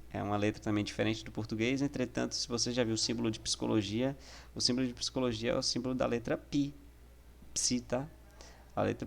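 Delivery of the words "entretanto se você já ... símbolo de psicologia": ordinary delivery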